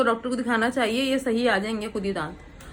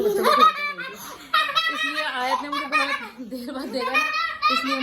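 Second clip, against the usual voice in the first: laughing; 3 dB quieter than usual. Real, not acted. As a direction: talk through your own giggles. Laughing while baby giggles.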